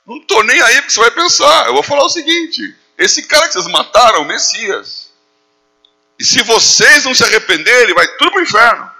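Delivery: bold, forceful, high-pitched street preaching; Pedro. Acted. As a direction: confront the crowd without caring what they think. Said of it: "Uma palavra de força".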